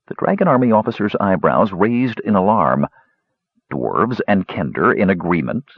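ordinary delivery

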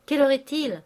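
haut et fort, pressé; question pressante. Acted. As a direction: jetez la question avec urgence. Eugène alarmé questionne vivement. (demande pressante et forte)

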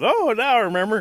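very loud, high exclamation; sudden realization. Acted. Bear reaching the hilltop and seeing rabbit, loud sudden realization. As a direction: blurt it out as it all comes back.

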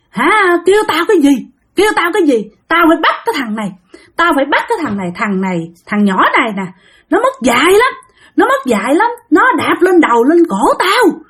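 put-on high-pitched, indignant spirit ranting through a boy; acted possessed tirade, pitched well up; possessed hollering. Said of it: "Nó đứng nó la làng".

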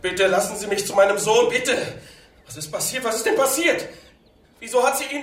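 anguished father, voice climbing very high; desperate pleading. Acted. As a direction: plead to be let through. Father begs to see son.

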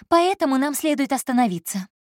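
a proud secretary holding her ground; Elsa's voice. Acted. Elsa speaks again.

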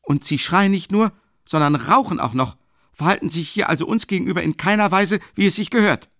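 loud, very high rebuke; student loudly rebuking the smoking lieutenant; indignant shouting. A narrator's reading. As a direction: scold him back with triumph. Student attacks lieutenant's manners.

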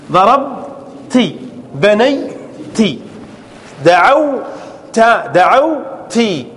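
ضربت and بنيت stretched slowly, ending lingered on; slow drawn-out demo. Shown as a demonstration. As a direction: lay out each ending slowly for students. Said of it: "teacher drawing out verb endings". (تمديد بطيء لنهايات الأفعال)

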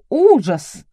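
sharp shocked cry; horrified gasp. A narrator's reading. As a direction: gasp it out in horror. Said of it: "ахнула Элла".

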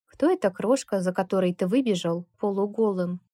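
usual speaking voice

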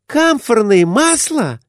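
high, eager lady's question; lively. A narrator's reading. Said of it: "оживлённо заговорила".

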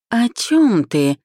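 high, slow bleat. A narrator's reading. Friend accused of faking a diagnosis, bleating. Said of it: "проблеял он".

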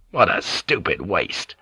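through his teeth. A narrator's reading. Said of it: "said through his teeth".

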